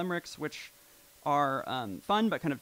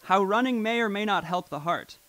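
slow, louder dramatic recital of couplets; mock-grand Shakespearean reading; poem recitation; 2 semitones higher, 8 dB louder, slower than usual. Shown as a demonstration. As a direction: declaim it like a stage sonnet. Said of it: "beautiful prophetic couplets".

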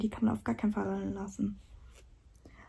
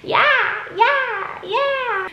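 very high squeaky yes; squeal; 12 semitones higher, 8 dB louder, much slower than usual. Acted. Squealing to puppy.

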